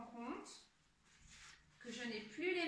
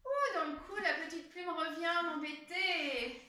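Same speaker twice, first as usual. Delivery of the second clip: playfully tickled; 5 semitones higher, 7 dB louder than usual. Pretend. Feather tickling again.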